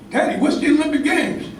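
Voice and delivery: a hint of a small boy questioning his dad; young boy asking. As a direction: ask it like a curious little boy. Kid voiced asking his father.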